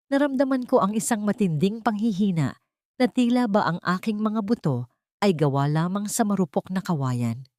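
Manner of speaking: ordinary delivery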